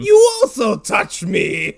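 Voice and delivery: very loud, high, slow villain roar; hammed-up indignation, hammering every word; outraged bellow. Alexander hit by drunk Achilles, slow outraged mock bellow.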